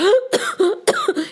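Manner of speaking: acted choking cough; shocked coughing. Rana chokes at what he sees, coughing. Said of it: "He was coughing".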